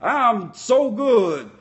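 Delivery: slow, drawn-out boast; lightly voiced smug wrestler; a hint of Dolph Ziggler's smug entrance. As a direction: stretch every word like a cocky wrestler. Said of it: "he's like".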